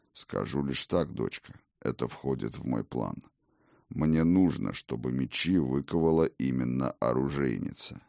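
put-on low, calm old god; old king voice. Odin explains his plan.

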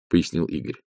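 ordinary delivery

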